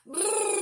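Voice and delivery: loud shivering brrr with trilled lips; cold brrr. Shown as a demonstration. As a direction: blow a loud lip trill like you're freezing. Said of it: "we're now going to do a brrr sound like it's very cold outside".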